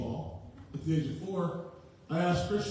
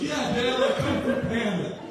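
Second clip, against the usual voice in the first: put-on higher and louder, an eager boy's voice; excited kid son; 8 semitones higher, 5 dB louder than usual. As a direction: play the thrilled little son. Son answering dad, retold.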